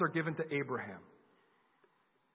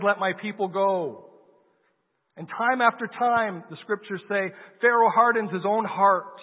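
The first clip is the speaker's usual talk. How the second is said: louder, higher preaching build; preaching at full force; 5 semitones higher, 7 dB louder than usual. Acted. Preacher telling the Exodus to the congregation, full-force preaching. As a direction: drive the story home to the congregation.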